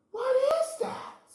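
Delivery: very high, fast surprised question. Pretend. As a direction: ask in wide-eyed wonder. Excited question to toddler.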